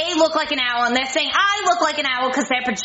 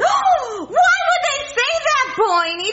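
shocked gasp; 8 semitones higher, much slower than usual. Acted. Gasps, offended.

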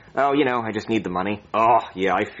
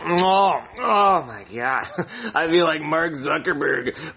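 slow, laughing indulgence; drawn-out, giggly luxury gloating; blissful chuckling; 3 semitones higher, much slower than usual. Acted. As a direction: savor it with a delighted laugh. Savoring a thousand dollar nugget.

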